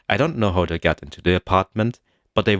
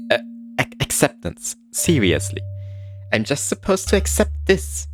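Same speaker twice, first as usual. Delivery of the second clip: stunned disbelief; 8 semitones higher than usual. Acted. Disbelief at intruder's answer.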